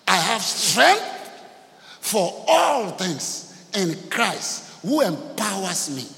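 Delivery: loud, slow, high scripture proclamation; preaching declamation. Acted. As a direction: proclaim it with drawn-out, ringing force.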